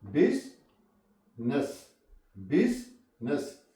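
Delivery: बिजनेस very slowly as "biz ... ness"; silent I. Shown as a demonstration. Teacher drilling business with learners, "biz ... ness". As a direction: pause between the two syllables of बिजनेस. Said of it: "यहाँ पे आई silent है".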